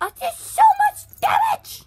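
high, loud squealing cheer; thrilled gaming squeal; excited squeal. Real, not acted. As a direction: shriek with triumph.

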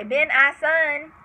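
"Ben, I son" said slowly as three words; split into parts. Shown as a demonstration. Teaching the spelling, splits the word slowly. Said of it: "So Ben can say".

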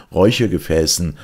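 plain reading voice

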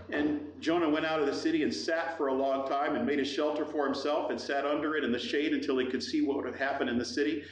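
ordinary talk

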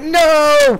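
very high, loud cry of dismay; despairing no. Retold or imitated. Player being pistol whipped, very high loud cry. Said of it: "and like".

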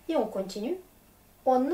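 ordinary talk

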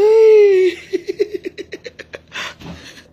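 loud, very high squealing laugh; shrieking laugh. Real, not acted. Streamer mocking rival's housing, shrieking laugh. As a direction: let out a long piercing cackle. Mocks homes for battered women.

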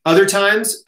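ordinary talk

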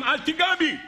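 crying out. Acted. Trees cry out to the first man, mock outcry. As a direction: call it out high as a protesting tree. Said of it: "העצים צעקו לאדם הראשון".

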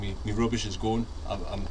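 ordinary talk, nothing marked

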